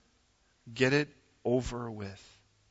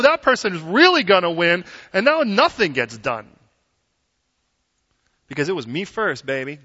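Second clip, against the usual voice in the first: ordinary talk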